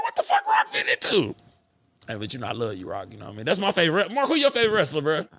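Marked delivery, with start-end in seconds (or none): 0.0-1.3 very high squealed mock shout; shocked outburst. Fan retelling shock at seeing The Rock, high mock shout. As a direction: squeal it out in exaggerated disbelief. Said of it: "I was like".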